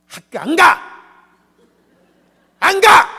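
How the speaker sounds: put-on high, loud child's tantrum yell; tantrum shout. Child yells at his mother about school, high tantrum shout. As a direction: scream it like a stubborn kid. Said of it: "땡깡 부리고 소리 지르면요".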